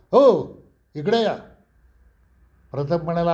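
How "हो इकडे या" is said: shouted, high and slow call back; loud call. Retold or imitated. Old owner shouting after customers leaving without paying, loud call. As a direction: holler at them to come back. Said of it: "म्हातारा जोरात ओरडला".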